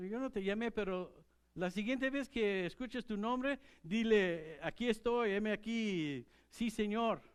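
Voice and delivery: lightly voiced priest giving instructions; instructing priest. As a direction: guide the boy kindly.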